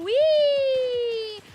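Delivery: gleeful sledding whee. Retold or imitated. Retelling kids sledding downhill, a high loud joyful whee. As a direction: shout it like a kid flying down a snowy hill. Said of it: "and going".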